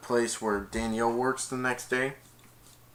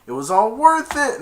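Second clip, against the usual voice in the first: triumphant; 11 semitones higher, 9 dB louder than usual. Retold or imitated. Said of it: "he said".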